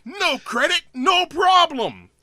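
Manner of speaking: high, loud drawn-out slogan; imitating ad pitchman. Car dealer delivering his slogan, hammed-up pitch. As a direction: hammer out the catchphrase, stretching the ending. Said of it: "He's like".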